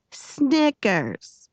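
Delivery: high, falling call of the name. A narrator's reading. Girl trying out the nickname aloud, declaring it. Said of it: "she said it loud".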